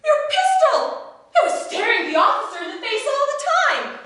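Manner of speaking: high, fast rush of alarm; young lady alarmed at the pistol; excited alarm. Acted. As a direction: blurt it out, alarmed. She spots the unloaded pistol.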